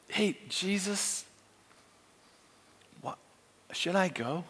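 soft, halting, hesitant prayer; tentative prayer. Acted. First time he's ever prayed, tentative prayer. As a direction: feel your way into a hushed question.

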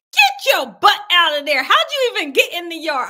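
mock scolding of a groundhog; scolding the groundhog in the yard, loud and fed up; cussing out. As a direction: tell the critter off, loud. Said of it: "I was cussing this thing out".

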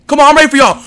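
normal talking voice

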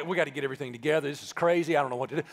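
fast, low mock-panicked rush; mock frantic. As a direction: rattle it off like a flustered planner. Acting out a frantic emergency meeting.

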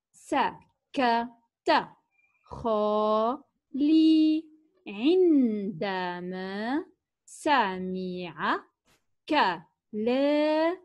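very slow, سكت خالي عندما سمع كلامي each stretched out and split by pauses; word by word. Shown as a demonstration. Teacher reading the sentence to children, very slow and word by word. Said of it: "لنقرأ معًا هذه الجمل".

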